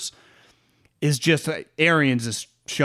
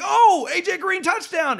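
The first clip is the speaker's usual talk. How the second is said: play-by-play call; 14 semitones higher than usual. Pretend. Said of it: "It's like".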